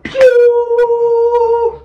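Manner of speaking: very high, loud pew; flying pew sound. Retold or imitated. Guy flying after the tackle, shrill pew. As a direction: squeal it like something launched. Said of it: "He was like".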